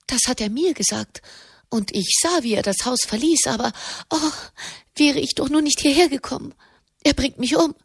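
breathless terror of a caught lover; panicking lady. Acted. Frightened woman fears husband.

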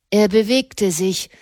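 plain reading voice

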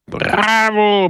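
high, loud cheer; roaring crowd. Acted. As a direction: shout it like a whole assembly. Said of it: "brüllte die Versammlung".

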